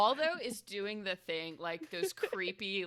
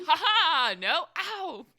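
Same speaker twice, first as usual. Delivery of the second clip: high, loud mock "ha ha, ow"; sarcastic pained reaction; 11 semitones higher, 10 dB louder, slower than usual. Player mocking her own wasted spell, pained and sarcastic. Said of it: "I'm like".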